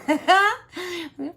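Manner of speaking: short bright laugh. Real, not acted. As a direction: burst of laughter. Laughs at own slip.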